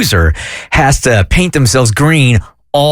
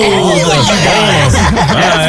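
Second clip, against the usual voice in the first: ordinary talk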